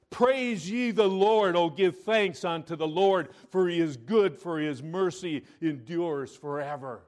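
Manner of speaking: loud, high scripture proclamation; preaching at full force. Acted. Preacher booming a psalm to the church, loud and high. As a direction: proclaim the verse to the rafters.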